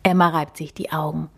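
usual speaking voice

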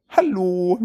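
drawn-out high meowing hallo; cat meme Hallo. Retold or imitated. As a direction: imitate a cat about to throw up. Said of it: "es klingt wie Oh Long Johnson".

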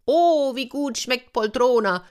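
höherer, nachäffender Zuruf vom Dach; spöttischer Ruf. A narrator's reading. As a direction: den Esser von oben necken. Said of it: "rief Piccino". (spöttisch rufend)

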